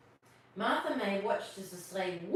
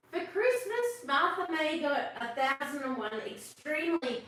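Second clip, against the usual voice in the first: excited build-up; 5 semitones higher, 4 dB louder than usual. Acted. Building up to the punchline.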